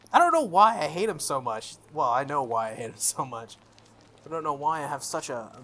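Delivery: normal talking voice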